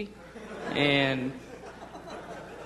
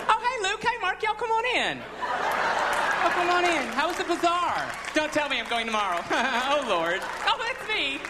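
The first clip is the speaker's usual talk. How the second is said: much higher, gushing Southern greeting; high camp Southern accent greeting; effeminate Southern Jesus; 11 semitones higher than usual. Pretend. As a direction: squeal hello to your friends, campy and Southern. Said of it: "Think of Jesus going".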